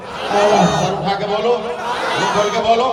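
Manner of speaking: rising, insistent call to the audience. Real, not acted. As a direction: push the crowd to speak up. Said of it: "जोर से बोलो".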